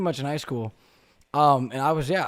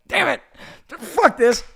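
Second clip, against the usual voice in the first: high, loud hammed-up outburst; mock frustration; 11 semitones higher, 7 dB louder, slower than usual. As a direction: blurt it in playful exasperation. Acted outburst at the editor.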